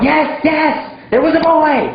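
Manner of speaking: high, eager shout; mock student shout. Student shouting back to the teacher, high and eager. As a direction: call it out like a keen pupil. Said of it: "Again, you shout".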